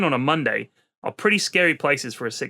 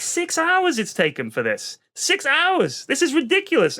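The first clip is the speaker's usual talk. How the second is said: slow then higher, indignant stress on hours; exasperated rant; 11 semitones higher, slower than usual. Retold or imitated. Father complaining to hospital staff, slow exasperated outrage. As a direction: spell out the outrage to the nurse. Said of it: "I was like".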